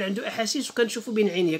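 usual speaking voice

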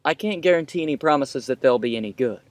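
usual speaking voice

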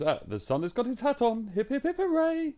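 high, loud singsong wake-up chant; mock-cheerful sunrise sing-along; cheery singsong. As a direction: belt it like a ridiculously chirpy morning person. Retold cheery wake-up at half four.

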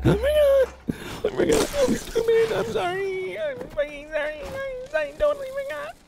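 very high, crying whine; whiny high voice. Pretend. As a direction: squeak it out like a sulking child. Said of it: "I don't know why I'm talking like that".

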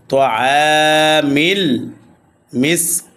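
slow recitation, long drawn final vowel in মিসকিন; three-alif madd. Shown as a demonstration. Teacher showing madd arzi at the pause, long stretch on মিসকিন. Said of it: "তিন আলিফ টানিয়া পড়িতে হয়".

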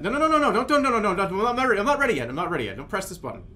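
high, loud, rapid-fire no-no-no; urgent flurry of pleading refusals; panicked protest. Real, not acted. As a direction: frantically stop someone, pitch jumping up. Panics as button nearly pressed.

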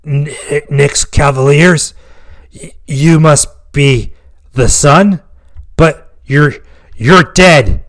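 halting high gasps, slow and stammering; struggling to speak. Acted. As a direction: stammer each word out like a dying man. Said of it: "Featherstone struggled to speak".

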